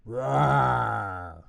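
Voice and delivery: loud far-off bear roar. Acted. Bear roaring from the next room, loud roar. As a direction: let out a big friendly roar.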